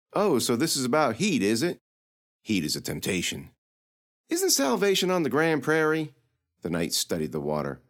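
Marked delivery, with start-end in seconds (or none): 0.1-6.1 put-on teasing girl voice, pitched high; sassy young girl. Lily needling the knight about heat, teasing. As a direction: poke fun at the knight's logic.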